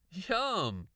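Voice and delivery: high, loud teasing; joking boy. A narrator's reading. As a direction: tease playfully in a kid's voice. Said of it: "Aaron laughed".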